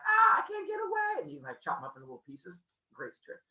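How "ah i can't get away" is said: very high squeaky plea; hammed-up high panic; mock panic. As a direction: squeal like a trapped cartoon. Brother trapped by spear.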